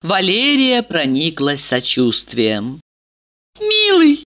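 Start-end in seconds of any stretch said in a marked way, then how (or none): 3.6-4.2 put-on high sugary girly voice; bubbly sorority girl. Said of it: "сказала она".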